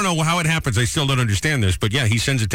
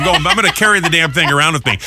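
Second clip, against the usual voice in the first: normal talking voice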